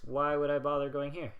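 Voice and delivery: ordinary delivery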